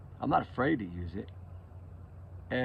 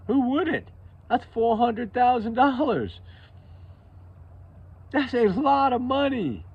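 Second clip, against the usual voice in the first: amazed; 10 semitones higher than usual. Real, not acted. Amazed at reward amount.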